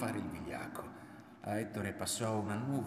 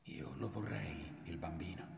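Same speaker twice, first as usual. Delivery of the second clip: murmured confession; 6 dB quieter than usual. A narrator's reading. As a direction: let it out softly with a small pause. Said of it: "Poi lei gli disse nel collo".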